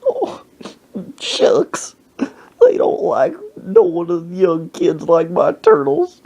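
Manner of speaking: high-pitched tearful whine; hammed-up old man sobbing; mock crying. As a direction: wail it slowly like a heartbroken old man. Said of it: "then he started crying".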